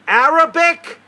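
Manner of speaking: retold official screaming; imitated scream. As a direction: yell it at the top of the voice. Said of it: "They screamed at her".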